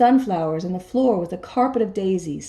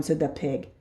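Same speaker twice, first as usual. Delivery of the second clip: usual speaking voice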